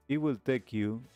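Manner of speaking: ordinary delivery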